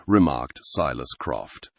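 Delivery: ordinary delivery, nothing marked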